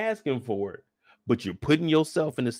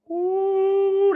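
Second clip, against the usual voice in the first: high ooh; 3 dB louder than usual. Retold or imitated. Smelling the cocaine.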